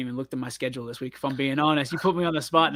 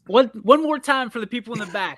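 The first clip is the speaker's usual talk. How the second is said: loud emphatic; 6 semitones higher, 5 dB louder than usual. Real, not acted. Calls for the point repeated.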